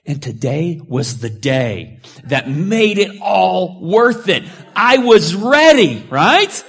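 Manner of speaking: triumphant. Real, not acted. Preacher boasting to congregation of his backups, triumphant and loud. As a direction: build to a gleeful climax.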